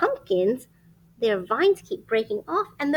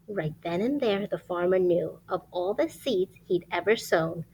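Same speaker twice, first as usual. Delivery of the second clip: lively rhyming storybook read; reading to children; 7 semitones lower than usual. Acted. Reading a rhyming picture book aloud to children. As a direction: read it like bedtime story time.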